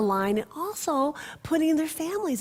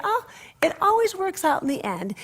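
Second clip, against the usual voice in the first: reassuring grandmother; 8 semitones higher than usual. Retold or imitated.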